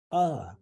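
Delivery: "Ah" as in taco, open vowel; pronounced ah. Shown as a demonstration. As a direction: hold up "Ah" as a model sound. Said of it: "It is pronounced ah".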